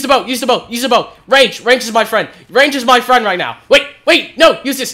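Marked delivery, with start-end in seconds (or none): none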